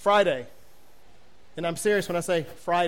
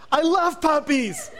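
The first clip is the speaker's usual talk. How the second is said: high, drawn-out hammed-up adoration; exaggerated puppy-lover squeal; mock gushing; 8 semitones higher, slower than usual. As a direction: gush slowly in a put-on high voice.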